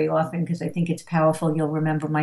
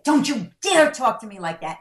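higher-pitched indignant telling-off; mock scolding parent; 6 semitones higher than usual.

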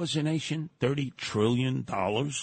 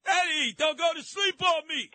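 calling out; 12 semitones higher than usual. Real, not acted. Host calling out to caller.

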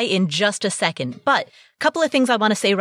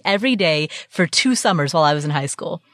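ordinary talk

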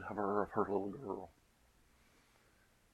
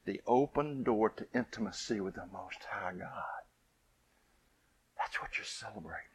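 whispered, much softer; hushed whisper; 6 dB quieter than usual. Real, not acted. Scene: preacher whispers to the congregation about intimacy with God.

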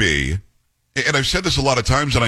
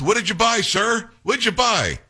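higher, pushy shouted question to the president; mild mock yell of a journalist; mock reporter yell; 8 semitones higher than usual. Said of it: "And then she yells".

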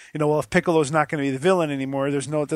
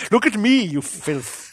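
lightly voiced smug villain, higher start; 6 semitones higher, 4 dB louder, slower than usual. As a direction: sneer it like a snooty movie villain. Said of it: "he sounds like Billy Zane from Titanic".

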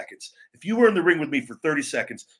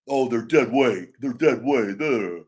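slow, lower, sneering mock of the fans; mocking critics; 3 semitones lower, slower than usual. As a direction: drag out dead weight with contempt. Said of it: "you fucking mark".

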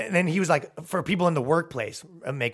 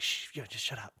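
hushed, much softer aside; 2 semitones lower, 12 dB quieter than usual. Retold or imitated. Boyfriend hushing his girlfriend in the crowd, much softer hiss. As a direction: shush her under your breath.

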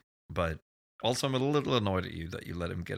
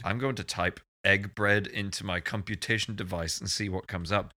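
normal talking voice

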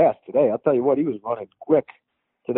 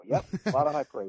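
ordinary delivery

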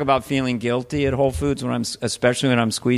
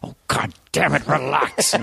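exasperated outburst, out of the hush; mock snap of impatience; lost temper. Horse whisperer giving up on horse, comic exasperation. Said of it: "with a temper".

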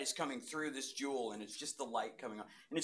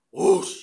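a sudden, much louder rushing whoosh; 6 semitones higher, 18 dB louder than usual. Retold or imitated. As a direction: throw out the whoosh like a gust of wind. Vision strikes in church.

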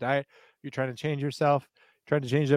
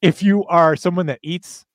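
high energy ad read; 4 semitones higher, 8 dB louder than usual. Real, not acted. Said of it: "put Tansy level energies into it".

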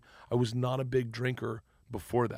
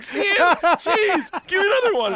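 high, loud, hungry for more; eager excitement; 10 dB louder than usual. Retold or imitated. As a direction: beg for the next word, thrilled. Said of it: "I got so excited".

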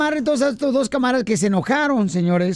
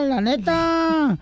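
drawn out; 3 semitones higher, much slower than usual. Real, not acted. Host teasing Chela.

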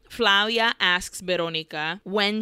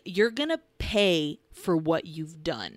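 mock-sinister, slightly lowered voice; menacing threat; 3 semitones lower than usual. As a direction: draw out the threat like a villain. Retold livid girl threatening.

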